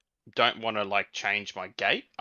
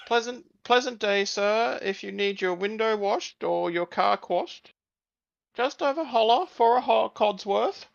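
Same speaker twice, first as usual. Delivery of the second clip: much higher, bright servant sing-song; cheery robot butler; 10 semitones higher, 3 dB louder than usual. Acted. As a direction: greet them like an eager butler. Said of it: "I will say".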